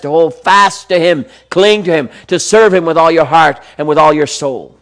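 rising, fervent Bible reading; preacher reading scripture with fervour; impassioned scripture reading. Acted. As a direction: build the verses with growing passion.

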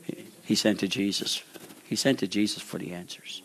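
hushed, breathy aside; whisper. Real, not acted. Preacher telling the congregation where John turned, whispered. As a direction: whisper it like a secret. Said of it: "whispered reveal".

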